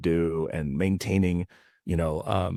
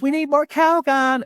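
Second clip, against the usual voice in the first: very high, loud mock-wife voice; wife calling out; 7 dB louder than usual. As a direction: call from the back room in a drawn-out falsetto. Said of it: "the wife in the back goes".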